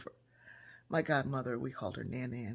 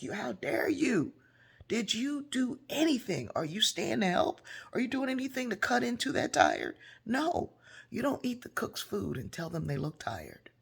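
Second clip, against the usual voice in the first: indignant scolding; 8 semitones higher, 3 dB louder than usual. Retold or imitated. Godmother scolds the guests.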